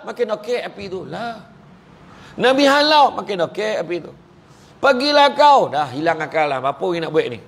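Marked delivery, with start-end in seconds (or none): 2.4-3.2 calls it out higher and louder; a preacher's sudden lift in voice; raised voice. Real, not acted. Preacher's raised comic retelling. 4.8-5.7 higher, louder command to the fire; hammed-up shout of pergilah kau; mock command.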